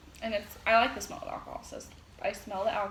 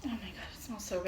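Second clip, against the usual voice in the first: savoring a smell; 3 semitones lower, 9 dB quieter than usual. Real, not acted. Sniffing the bourbon for review.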